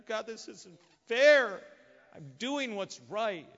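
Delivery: high, slow, loud whining protest; whiny complaint. Acted. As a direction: whine it like a wronged man. Elijah complaining to God.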